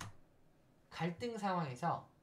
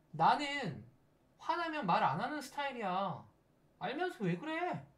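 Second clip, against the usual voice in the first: higher, petulant whine; hammed-up childish huff; mock child-ego sulk; 6 semitones higher, 3 dB louder than usual. Person sulking at a partner mid-fight, mock petulant. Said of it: "아이자인 거예요".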